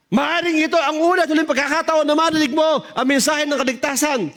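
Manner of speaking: fierce preaching. Retold or imitated. Preacher woman turning fierce, warning him of hell. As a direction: roar it like a lioness. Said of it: "naging tigre".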